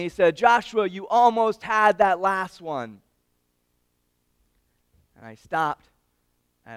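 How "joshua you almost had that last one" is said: dad yelling encouragement. Retold or imitated. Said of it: "he yelled at me".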